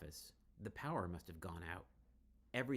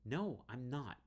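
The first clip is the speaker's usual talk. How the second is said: matter-of-fact; 6 semitones higher, 5 dB louder, slower than usual. Acted. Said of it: "Riley says matter-of-factly".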